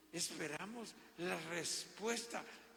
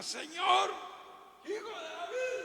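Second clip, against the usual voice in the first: very high, loud cry; high, loud pleading shout; shouted plea; 13 dB louder than usual. Acted. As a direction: call out to him desperately. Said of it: "esta mujer estaba".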